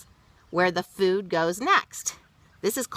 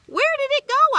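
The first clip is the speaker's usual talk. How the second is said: put-on high, eager child's question; curious child; 7 dB louder, faster than usual. Child asking grandma where worm went, high eager voice. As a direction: ask like a wide-eyed kid. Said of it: "I asked".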